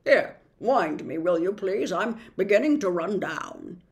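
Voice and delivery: put-on calm the boy like a steady dog; reassuring dog. A narrator's reading. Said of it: "raising one paw assuringly".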